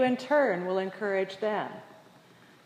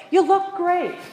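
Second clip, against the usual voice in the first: high, loud, bubbling praise for a struggling skier; cheerleading a beginner skier on the trail, much higher and louder; gushing cheer; 8 semitones higher, 9 dB louder, slower than usual. Retold or imitated. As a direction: sound thrilled to see her again. Enthusiastic woman cheers her on.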